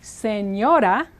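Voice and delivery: sen-yo-da, drawn out; slow careful Spanish. Shown as a demonstration. Teacher modelling Señora slowly. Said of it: "which means ma'am or missus".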